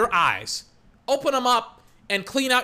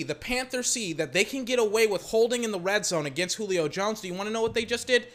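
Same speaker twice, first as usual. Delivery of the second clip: ordinary delivery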